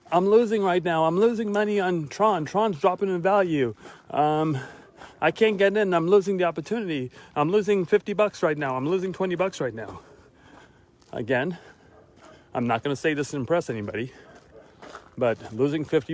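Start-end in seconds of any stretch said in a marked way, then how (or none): 0.1-10.0 high-pitched mock whining about lost money; mock whiny loser. Mocking people on his page who whine about losses, in a high whiny voice. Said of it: "I saw people on there saying".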